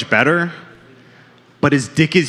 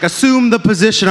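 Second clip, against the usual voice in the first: doctor's order; 6 semitones higher than usual. Retold or imitated. Doctor voiced in bit.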